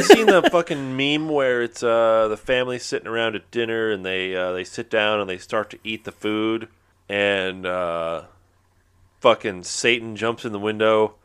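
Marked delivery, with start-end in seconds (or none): none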